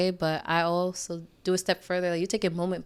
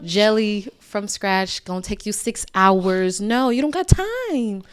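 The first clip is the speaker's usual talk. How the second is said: emphatic; 3 semitones higher, 9 dB louder than usual. Real, not acted. Emphatic advice, loud.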